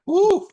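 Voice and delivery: high, loud whoop; excited whoop. Real, not acted. Game master cheering a player's natural twenty, excited whoop. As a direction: whoop at a lucky roll.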